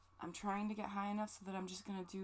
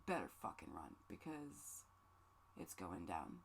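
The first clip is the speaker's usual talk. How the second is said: a hammed-up low threat; mock menace; 11 semitones lower, 5 dB quieter than usual. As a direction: growl the warning, half joking. Mock threat about crying angry.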